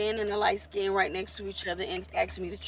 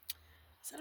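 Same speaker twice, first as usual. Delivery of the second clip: usual speaking voice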